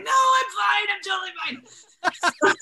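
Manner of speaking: denying labor to her husband, high and laughing; mock high denial. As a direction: laugh through insisting she's fine. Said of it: "I'm like".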